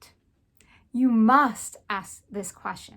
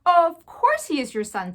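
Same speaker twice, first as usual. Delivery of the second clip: French mother; 6 semitones higher, 6 dB louder than usual. A narrator's reading. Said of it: "said Antoinette".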